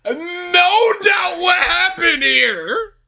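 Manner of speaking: high, slow laughing mock shout; hammed-up gloating. Co-host joining in as gloating Voldemort. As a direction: proclaim it like a villain. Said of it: "joining the Voldemort impression".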